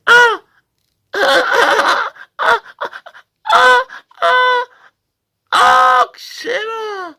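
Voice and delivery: very high yelping animal cries ending in a slow shout; feline mating cry. Pretend. Salesman demos the animal mix, high wild cries. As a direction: shriek like a panther in heat. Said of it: "je vous fais un mix".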